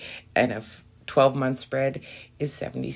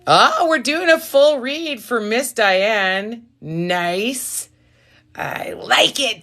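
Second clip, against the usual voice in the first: high, drawn-out delight; thrilled; 7 semitones higher, 5 dB louder, much slower than usual. Real, not acted. Reader learning Diane wants a full read, thrilled. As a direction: stretch it out with big excitement.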